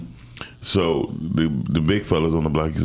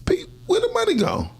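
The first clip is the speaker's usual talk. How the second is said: much higher imitation of mom asking; mother demanding. Mother questioning her son about missing money, high and sharp. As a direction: put on mom's voice, suspicious. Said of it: "She like".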